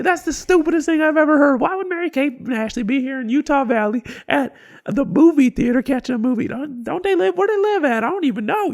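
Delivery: high, loud incredulous ridicule; mocking rant. Worker roasts the customer before the whole line, ranting high. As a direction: go all in roasting her.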